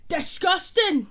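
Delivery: loud, high outburst; sudden high-pitched mock shout; disgusting meme. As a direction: exclaim it like the meme. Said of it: "I know half of yous know of the meme".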